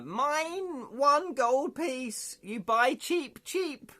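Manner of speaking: very high, slow hobgoblin squeal; hobgoblin mock scream. Hobgoblin offering to sell the bell, high mock-scream voice. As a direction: squeal it out in drawn-out pretend agony. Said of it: "pretends to scream".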